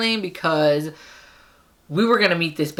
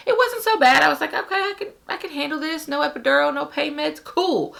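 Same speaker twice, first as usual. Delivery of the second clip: high mock-casual voice; 11 semitones higher than usual. Mimicking her earlier calm self.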